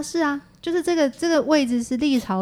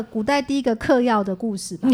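normal talking voice

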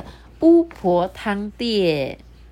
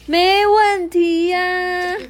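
much higher, louder, slow cheerful reply; high drawn-out happy chorus; happily; 11 semitones higher, 5 dB louder, much slower than usual. A narrator's reading. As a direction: answer with delighted eagerness. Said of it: "很开心地说".

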